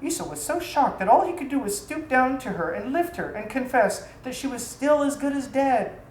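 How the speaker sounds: ordinary delivery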